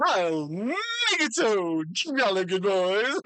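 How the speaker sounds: high, slow jolly toff saying jolly good; hammed-up jolly old chap, then a laugh; jolly gentleman voice. GM voicing James, posh.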